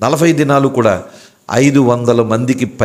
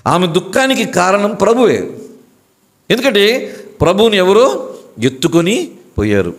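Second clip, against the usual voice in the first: higher, ringing sermon build; preaching; 8 semitones higher than usual. Acted. Preacher telling the church why Mary wept, rising sermon cadence.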